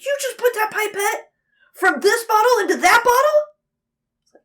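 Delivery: high, strained voice of an upset teacher; high, incredulous teacher impression; freaking-out teacher. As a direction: mimic the teacher losing it. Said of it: "freaking out".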